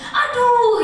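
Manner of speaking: loud, high cry of pain; pained "aduh". Shown as a demonstration. Coach demonstrates one word as hurting, loud pained cry. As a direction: yelp it as if hurt. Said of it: "Iya, sakit".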